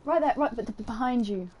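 ordinary delivery

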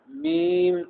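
मीम with a nasal, high vowel; wrong nasal version. Shown as a demonstration. Teacher showing the wrong nasal मीम. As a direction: say मीम through the nose. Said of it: "ये तलफ़ुज़ भी गलत है".

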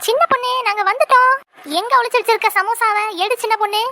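high, excited arrival call; teasing, high-pitched hail; playful calling out. Acted. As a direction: sing out a cheerful announcement to a friend.

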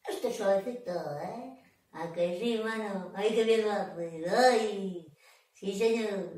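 low, soft, soothed voice; tender cooing. Pretend. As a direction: coo softly as if being stroked gently. Showing a gentle caress.

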